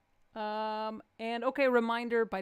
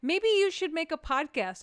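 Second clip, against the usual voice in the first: high, louder pointed suggestion; mock wife suggestion; 7 semitones higher, 7 dB louder than usual. Comedian voicing her wife's suggestion, high and pointed. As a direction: deliver the wife's idea as obvious. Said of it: "And she goes".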